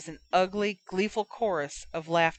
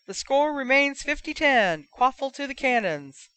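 higher announcer call of the score; commentator; 7 semitones higher, 3 dB louder than usual. Acted. Match commentator voice.

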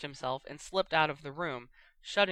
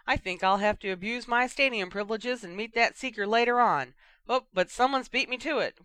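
lively, higher Quidditch announcer voice; radio commentator; 5 semitones higher, 5 dB louder than usual. Acted. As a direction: call the match like a cheeky sports commentator. Lee Jordan commentating on the wireless.

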